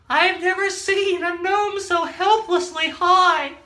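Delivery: high, slow amazed onlooker; high drawn-out voice. Acted. Bystander marveling at the gnome, high and slow. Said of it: "someone looked up there and said".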